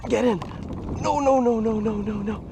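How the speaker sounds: real alarm as the catch slips; panicked urgency. Real, not acted. Angler pleading with a barely hooked fish, panicked. Fish nearly escapes the net.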